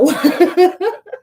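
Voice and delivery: high burst of laughter; laughing. Real, not acted. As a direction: laugh out loud. Reacting to tarot card.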